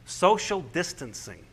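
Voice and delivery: slow, drawn-out quoted term; measured delivery, letting the term land; deliberate unveiling. Retold or imitated. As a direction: stretch out each word for the audience. Quoting the new term with weight.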